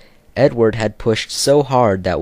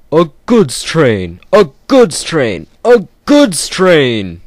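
slow, loud grumble building higher; grumbling; 7 semitones higher, 7 dB louder, slower than usual. Acted. Gordon disgusted at pulling coal trucks, slow building grumble. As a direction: sulk louder with each repeat. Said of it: "he grumbled".

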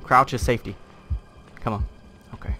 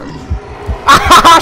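triumphant laugh; 14 dB louder than usual. Real, not acted.

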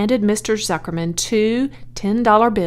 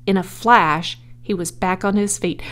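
ordinary delivery, nothing marked

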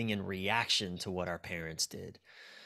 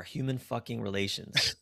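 ordinary talk

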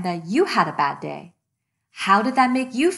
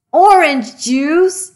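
slow, louder groan of disbelief; dismayed disbelief; 4 semitones higher, 5 dB louder, slower than usual. Acted. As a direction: stretch it out in horror. Another mess appears.

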